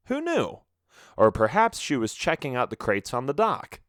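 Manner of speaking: ordinary delivery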